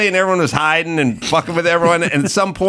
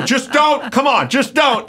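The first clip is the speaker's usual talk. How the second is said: pleading shout; 9 semitones higher than usual. Retold or imitated. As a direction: yell at your buddy to quit it. Begging Ray to stop.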